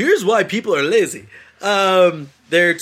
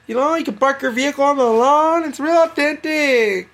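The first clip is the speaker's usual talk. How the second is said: high, slow put-on Mexican accent; mock Mexican accent; 9 semitones higher than usual. Stereotype voice of Mexicans.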